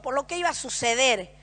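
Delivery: ordinary delivery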